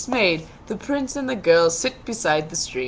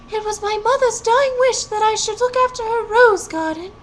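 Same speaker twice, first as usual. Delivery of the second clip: high, sighing maiden voice; much higher girl's voice with a sigh; 13 semitones higher, 7 dB louder than usual. A narrator's reading. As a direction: sigh it out as the sad young girl. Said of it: "sighs the girl".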